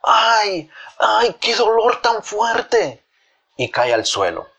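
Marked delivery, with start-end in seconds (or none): 0.0-3.0 high, drawn-out mock wail of pain; hammed-up "ay" of sudden pain; feigned cry of pain. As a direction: cry out in pretend agony, pitched well up. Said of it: "De repente Avispado grita".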